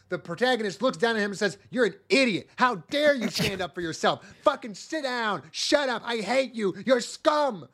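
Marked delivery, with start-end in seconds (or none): 1.7-7.7 hammed-up angry dressing-down; mock-furious boss putting someone down; scolding tirade. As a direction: berate an underling in raised, punched phrases. Voices protagonist berating the rebel.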